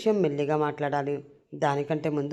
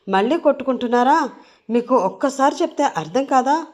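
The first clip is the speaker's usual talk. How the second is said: loud, higher-pitched cross questions; exasperated scolding; 6 semitones higher, 5 dB louder than usual. Acted. Sister catching the two fighting again, loud exasperation. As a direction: scold them in a raised voice.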